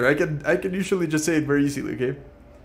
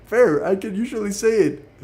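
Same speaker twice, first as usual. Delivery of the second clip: much higher and louder, laughing frustration; exasperated outburst; 9 semitones higher, 10 dB louder than usual. Real, not acted. Streamer losing it over the tongue twister, loud and laughing. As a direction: cry out at failing it yet again.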